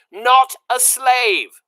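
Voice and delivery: slow, high, loud correction; shouted slowly. A narrator's reading. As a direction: hammer it home to a deaf girl. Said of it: "shouted the soldier".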